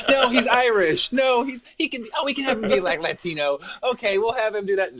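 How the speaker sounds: camp it up as panicky producers pitching ideas; mocking studio execs. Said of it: "they're like".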